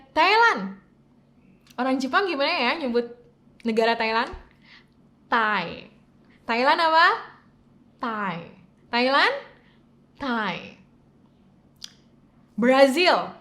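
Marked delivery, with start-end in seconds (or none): none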